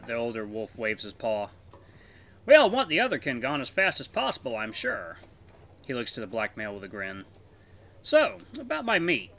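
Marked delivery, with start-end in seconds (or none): 2.5-5.2 put-on higher, genial older wolf voice; friendly older wolf. Elder wolf offering to take the pup as apprentice, genial and higher. Voices Vithir in visual novel. 8.0-9.3 put-on higher, genial older wolf voice; friendly older wolf. Elder wolf offering to take the pup as apprentice, genial and higher. Voices Vithir in visual novel.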